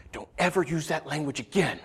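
soft, restrained imitation of a scolding; quiet stern rebuke. Wife to her cursing husband, quiet stern rebuke. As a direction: rebuke softly and firmly.

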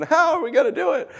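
high, whiny helpless question; mock worried wail. Storyteller as the underdogs, high mock worry. Underdogs fret about bad team.

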